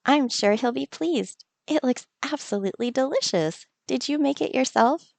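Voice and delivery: lightly voiced fond villager; Kara's voice. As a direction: voice Kara touched by the gift. Voicing Kara in game.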